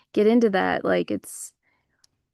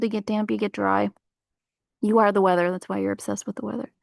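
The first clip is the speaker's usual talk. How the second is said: normal talking voice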